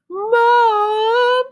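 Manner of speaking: high, loud cry of "Mom"; calling out. Acted. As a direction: call across the house. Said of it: "cried Splat".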